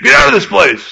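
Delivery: high mock yell in the foyer; hammed-up yell from the back. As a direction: call out like a rude intruder. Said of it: "yelled in the back of the foyer".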